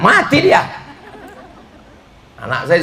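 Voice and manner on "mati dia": exasperated outburst. Real, not acted. Exclamation after bad advice.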